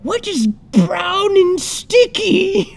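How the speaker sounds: very high, slow villain voice; high-pitched, teasing cartoon villain; Riddler. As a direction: draw the riddle out in a high squeal. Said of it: "he says a riddle".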